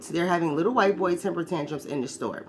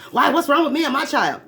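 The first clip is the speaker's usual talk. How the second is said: indignant mother; 8 semitones higher, 7 dB louder than usual. Retold or imitated. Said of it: "what do you start doing".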